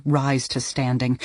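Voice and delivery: ordinary delivery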